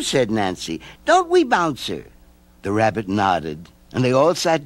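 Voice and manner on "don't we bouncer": put-on high little girl. A narrator's reading. Nancy reassuring the old man, high girl voice. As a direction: voice her high and sweet.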